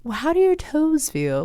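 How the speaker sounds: a cute British meditation-app guide, gently asking; British accent. Retold or imitated. Therapist imitating the Headspace app guide, high British accent. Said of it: "like, a little Aus-, Australian or British guy".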